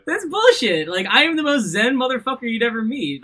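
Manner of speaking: high, loud indignant protest; indignant outburst. Real, not acted. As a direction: protest loudly in mock outrage. Protesting being called aggressive.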